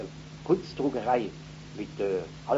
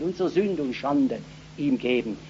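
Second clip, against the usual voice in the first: ordinary talk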